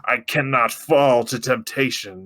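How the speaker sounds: slow, restrained voice fighting temptation; strained Picard. Retold or imitated. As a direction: hold back with every word. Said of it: "Picard is like".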